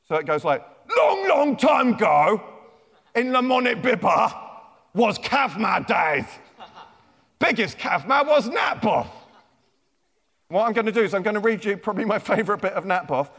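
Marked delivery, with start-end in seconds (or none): 0.9-9.1 caveman speak. Shown as a demonstration. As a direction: boom it out like a caveman telling tales. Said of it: "written in caveman speak".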